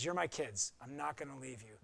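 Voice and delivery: soft, low voice of God; softly voicing God reassuring his children; tender reassurance. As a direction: comfort them gently as a father.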